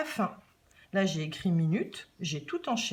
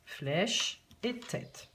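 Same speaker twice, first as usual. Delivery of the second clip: "flèche et tête" very slow, each word stretched; slow, careful teacher demonstration; model words drawn out; much slower than usual. Shown as a demonstration. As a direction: present "flèche" and "tête" slowly as examples. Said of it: "J'ai repris ces deux mots".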